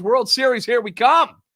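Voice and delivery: loud, high mock cheer of an overeager fan; sarcastic fan's cheer; mock hype. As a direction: ham up the premature celebration. Mocking overexcited fans.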